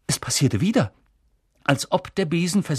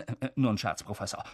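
sheepish; 3 semitones lower, 8 dB quieter, faster than usual. Acted. Caught out by the professor.

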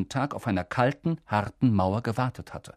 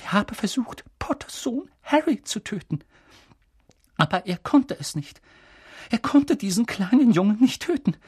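high, agitated, starting half-whispered and building louder; trembling voice; 12 semitones higher, 4 dB louder than usual. A narrator's reading. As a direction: begin shakily in near-whisper, grow high and intense. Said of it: "fuhr mit zitternder Stimme fort". (mit zitternder, hoher Stimme, zunehmend erregt)

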